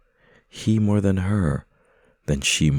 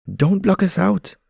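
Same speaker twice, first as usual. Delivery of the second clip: high, loud pigeon voice pleading; protesting pigeons; 13 semitones higher, 6 dB louder than usual. Acted. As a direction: pigeons protest being shut out. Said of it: "the pigeons protest".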